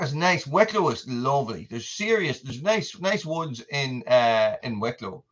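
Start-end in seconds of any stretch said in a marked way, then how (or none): none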